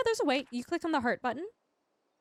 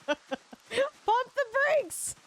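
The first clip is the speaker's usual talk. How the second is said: laughing; 12 semitones higher, slower than usual. Real, not acted. Telling chat to stop, laughing high. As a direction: squeak it out between laughs. Laughing at own near slip.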